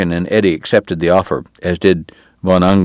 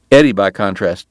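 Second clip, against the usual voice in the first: plain reading voice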